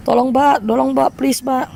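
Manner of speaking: high, repeated begging, acted out; urgent pleading. Stranded driver begging the tyre patcher at night, high plea.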